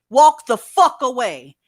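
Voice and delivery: loud, slow, every word punched; emphatic command. Real, not acted. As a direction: bark it as a stern order. Scolding people who hit elderly.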